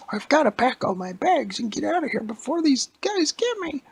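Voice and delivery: very high, loud, panicky granny; a squeaky old woman in a rush; old lady voice. Acted. Old lady packing in panic before the monsters arrive, high and squeaky.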